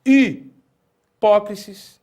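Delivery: hipócrisis slow, starting on the i without h; without aspiration. Shown as a demonstration. Teacher shows the unaspirated reading. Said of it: "eu leria".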